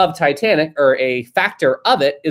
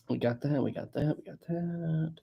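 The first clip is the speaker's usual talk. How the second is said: muttering; 15 dB quieter than usual. Real, not acted. Murmuring while reading chats.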